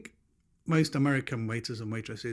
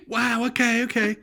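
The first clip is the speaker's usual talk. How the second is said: high, loud impressed gush; hammed-up amazement; mock overexcitement; 10 semitones higher, 7 dB louder, slower than usual. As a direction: imitate a thrilled stranger, pitched up. Mimicking the overexcited package clerk.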